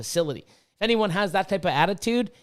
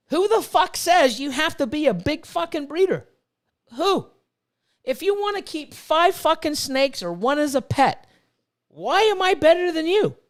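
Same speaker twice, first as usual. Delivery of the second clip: higher and louder, hammering each question; heated rant; 8 semitones higher, 3 dB louder than usual. Real, not acted. Ranting at snobby breeders, angry and raised. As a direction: demand to know who made the rule.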